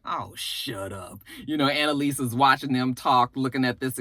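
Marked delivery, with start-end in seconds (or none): 0.0-1.2 slow, much softer, lower eye-roll; low, slow, soft eye-rolling dismissal; dismissive. Real, not acted. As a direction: brush it off, unimpressed and slow. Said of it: "I was just like".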